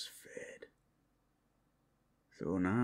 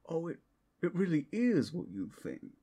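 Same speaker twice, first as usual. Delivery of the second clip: higher, louder, fast menacing glee; gloating reveal; 9 semitones higher, 4 dB louder, faster than usual. Acted. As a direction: savour the reveal. Werewolf reveals true nature, gloating.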